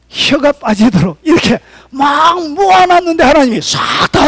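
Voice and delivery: crying out high and loud, voice straining; a fervent preacher's wailing climax; impassioned preaching. Acted. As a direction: preach it like a lament over lost toil. Preaching at full force.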